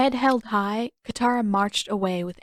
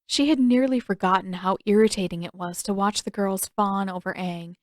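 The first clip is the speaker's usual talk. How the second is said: plain reading voice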